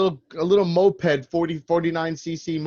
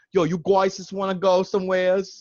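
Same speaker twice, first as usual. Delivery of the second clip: higher, exaggerated Long Island accent; playful Long Island guy asking friends out; 5 semitones higher than usual. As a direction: put on a thick New York Long Island voice. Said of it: "some kind of, like, accent".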